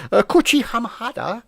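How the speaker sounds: slow put-on Japanese accent. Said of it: "that's a great Japanese accent you got".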